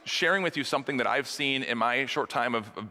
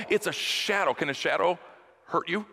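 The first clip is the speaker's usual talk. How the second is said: ordinary talk, nothing marked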